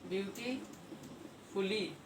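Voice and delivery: slow, broken-up beautifully with a long pause inside; drawn out. Real, not acted. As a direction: sound out beautifully in parts while writing it. Sounding out word while writing.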